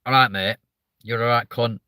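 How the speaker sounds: friendly Mancunian greeting, almost endearing; Mancunian accent. Retold or imitated. Streamer showing how nice northern swearing sounds, Mancunian accent. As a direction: put on an easygoing Manchester accent. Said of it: "if a Mancunian goes".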